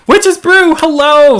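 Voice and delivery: high, loud happy hello; squealing hello to a chat regular; excited greeting. Real, not acted. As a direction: call out a bright welcome to a viewer.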